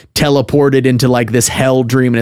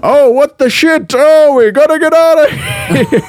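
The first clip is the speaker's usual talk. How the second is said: high, loud mock panic; imitating a frantic game character; hammed-up panicked yell; 5 dB louder than usual. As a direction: holler like a cartoon character fleeing for his life. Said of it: "your character's like".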